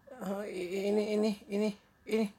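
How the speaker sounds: high, stuttering fake granny; stumped stammering. Disguised wolf has no answer left, stammering. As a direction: stall and stutter for an excuse. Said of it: "serigalanya udah nggak tahu tuh mau jawab apa".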